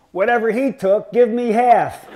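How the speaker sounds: loud, high heckle cutting the silence; yelled wisecrack. Retold or imitated. Brad Buxton heckling in a silent lecture hall, loud and high. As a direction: bellow it from the back row for the laugh. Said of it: "yelled out in his dead silence".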